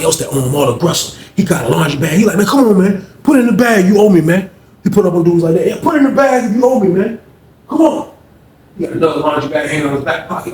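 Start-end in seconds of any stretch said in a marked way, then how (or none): 2.4-4.5 higher and louder pushy shakedown; aggressive demand. Retold or imitated. Fred pressing inmates to pay up, loud and aggressive. 5.7-8.1 aggressive demand. Retold or imitated. Fred shaking down inmates.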